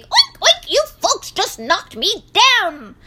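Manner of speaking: high, loud indignant pig; oink, then squeal the complaint; piggy squeal of protest. A narrator's reading. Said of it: "squealed Roly Pig".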